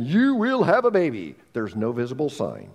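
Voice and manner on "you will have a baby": higher, lightly voiced voice from heaven; mock thundering voice. Preacher imagining God announcing to Hannah, mock thundering. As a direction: pretend to proclaim it from the clouds. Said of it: "no voice thundering from the heavens saying".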